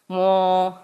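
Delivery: long mooed turtle call; mother turtle. Retold or imitated. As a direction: lightly imitate a big turtle calling. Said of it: "the female turtle goes".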